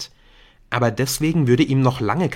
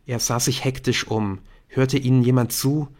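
ordinary delivery, nothing marked

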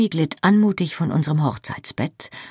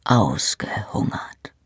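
slow, low husky whisper; a slow teasing whisper in bed; 6 semitones lower, 4 dB quieter, slower than usual. Acted. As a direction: breathe it close and slow. Lovers in bed, teasing reply.

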